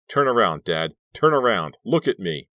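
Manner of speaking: yelling. A narrator's reading. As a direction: bark it at his back, furious. Son yelling at father's back.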